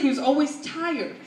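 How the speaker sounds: ordinary delivery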